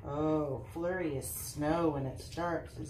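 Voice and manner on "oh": usual speaking voice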